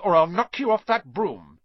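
high, loud threat; calling out. A narrator's reading. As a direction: call a threat to a rival. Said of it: "Harry called".

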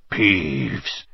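low, hoarse ghostly voice; hoarse whisper. A narrator's reading. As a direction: rasp the name out low. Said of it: "in a hoarse whisper".

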